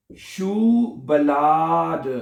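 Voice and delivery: शूबलाद very slowly, each syllable stretched; broken into parts. Shown as a demonstration. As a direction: draw out शूबलाद as "shuu... ba-laa-de". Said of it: "यहां तक बनेगा शू".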